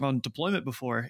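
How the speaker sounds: usual speaking voice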